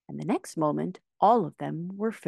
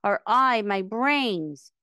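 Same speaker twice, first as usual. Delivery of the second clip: wailing; 5 semitones higher, 4 dB louder, much slower than usual. A narrator's reading. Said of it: "wailed the Scarecrow".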